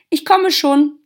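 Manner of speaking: high, loud answering call; calling back. A narrator's reading. Girl answering her mother from the garden, calling back. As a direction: shout back that you're on your way. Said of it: "rief das Mädchen zurück".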